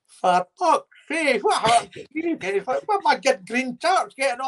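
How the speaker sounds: lightly voiced exasperated kit man; imitating the kit man. The kit man reacts to a bloodied player, high mock exasperation.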